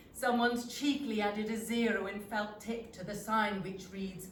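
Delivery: ordinary delivery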